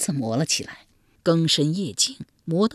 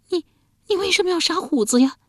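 tearful; 13 semitones higher than usual. Acted. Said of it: "且带着哭腔".

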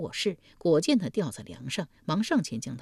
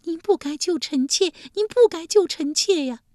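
high, louder wailing concubine; high-pitched sobbing plea; wailing cry; 12 semitones higher, 7 dB louder than usual. Acted. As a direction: cry out the words in a high wail. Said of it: "嚎的一声哭起来".

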